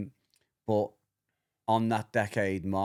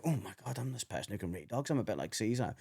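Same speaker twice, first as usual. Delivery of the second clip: softer, as if to oneself; hushed inner thought; 7 dB quieter than usual. Retold or imitated. Retelling own awed inner thought.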